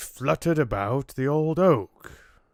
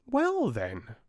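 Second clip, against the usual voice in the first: high, piping tiny fly voice; little fly; 11 semitones higher than usual. A narrator's reading. Tiny fly questions the oak, high piping voice. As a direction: squeak it out like a tiny insect. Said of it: "said the little fly".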